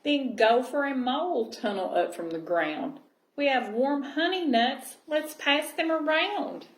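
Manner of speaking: gentle storybook reading voice; cosy read-aloud storytelling; reading to children. Acted. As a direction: read it like a bedtime picture book. Reading picture book aloud.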